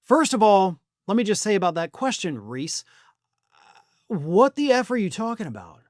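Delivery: ordinary delivery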